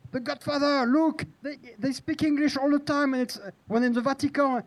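high, mock-insistent director voice; quoting the director. S0 mocking the director who insists they speak English. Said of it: "And the director said".

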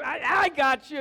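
loud, high mock "gotcha"; playful shouted boast; acted chase shout. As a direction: call it out big and high, hamming up the chase. Acts out a chase.

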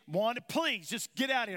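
loud, high pleading yell; a sweaty husband yells at his wife to go; exasperated shout. Retold or imitated. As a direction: beg her to leave, fed up. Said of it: "And she skipped".

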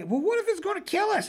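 put-on high, frightened little kid; child afraid to go on, high and fearful; scared kid voice. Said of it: "scared kids in the hallway".

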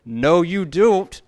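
loud, high, slow feisty judge shutting someone down; Judge Judy impression. Imitating TV judge to a guy giving attitude, feisty loud retort. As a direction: bark it like a TV judge losing patience. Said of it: "If you're doing a Judge Judy impersonation".

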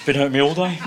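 mild Tommy Cooper impression after a pause; lightly voiced comic, a beat of throat-clearing first; imitating Tommy Cooper. Telling the anecdote, a mild Tommy Cooper delivery. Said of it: "leaves that gap and goes".

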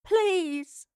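high, drawn-falling whimper; whimpered plea. A narrator's reading. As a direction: plead helplessly. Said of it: "Evie whimpered".

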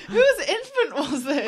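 high, loud laughing question. Real, not acted. Laughing disbelief.

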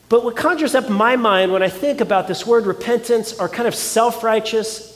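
ordinary talk

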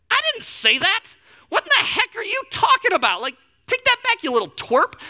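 high, loud mock-indignant protest; mock outrage. Uncle denies what his niece pinned on him, mock outraged. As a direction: protest like the wrongly blamed uncle.

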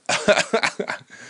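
high laugh after the punchline; short real laugh, pitched high. Real, not acted. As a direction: laugh at your own story. Laughs at his own story.

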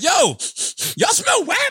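high-pitched comic outburst; high, hammed-up question; acted incredulous quote. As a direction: pipe up high, acting out a dude catching a smell. Acts out dude smelling the car.

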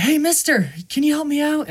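high, quick mock plea; calling for help. Trapped kid hailing a passing stranger, calling for help.

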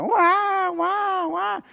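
high, short imitated newborn wails; light imitation of a baby crying. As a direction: mimic a newborn's first cries, fading off.

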